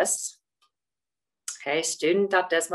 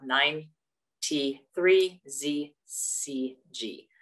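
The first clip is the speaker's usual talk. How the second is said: slow dictation; much slower than usual. Real, not acted. Dictating a join code.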